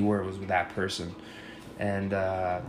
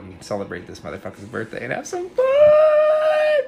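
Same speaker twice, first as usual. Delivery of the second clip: excited sign-off call; 4 dB louder than usual. Real, not acted. Hyping the birthday celebration.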